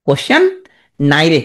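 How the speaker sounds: কোশ্চেন rising, pause, নাই রে falling; mnemonic pronunciation. Shown as a demonstration. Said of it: "তুমি এইভাবে উচ্চারণ করবা". (ভেঙে "কোশ্চেন, নাই রে")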